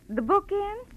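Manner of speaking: high rising question; surprised query. Acted. Shopkeeper on the phone checking an order, high and surprised. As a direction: catch the word in mild surprise.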